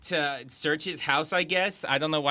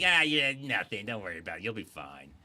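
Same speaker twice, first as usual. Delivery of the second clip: mock dismissive; 6 semitones lower than usual. Husband brushing off his family's danger, mock dismissive.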